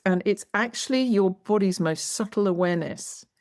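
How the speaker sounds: ordinary delivery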